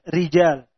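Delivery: rijal with a light, thin r, tongue root down. Shown as a demonstration. Teacher corrects rijal for students, thin ra.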